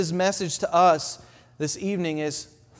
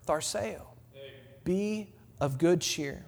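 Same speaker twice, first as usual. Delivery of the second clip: much softer and slow, lingering on each word; soft slow reminder; 3 semitones lower, 10 dB quieter, slower than usual. Shown as a demonstration. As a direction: close the sermon with a tender hush.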